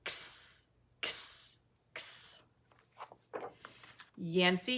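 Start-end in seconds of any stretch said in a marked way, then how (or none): 0.0-2.4 "X" as a voiceless "ks"; letter-sound drill. Shown as a demonstration. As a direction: click and hiss each "X" as "ks", no vowel. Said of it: "Xavier Fox says".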